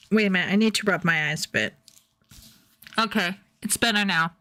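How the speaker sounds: ordinary talk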